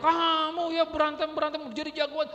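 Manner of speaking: loud, high scolding yell; menyalahkan anak. Acted. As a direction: lay into the child at full volume. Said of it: "kita udah nyalain anak". (bentakan orang tua yang marah)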